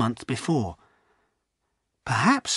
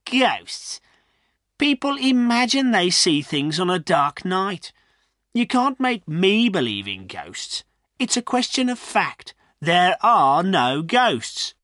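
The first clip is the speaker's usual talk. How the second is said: higher-pitched, scornful dismissal; 7 semitones higher than usual. A narrator's reading. Farrier dismissing villagers' talk of ghosts, scornful. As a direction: scoff at the very idea. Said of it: "The farrier laughed scornfully".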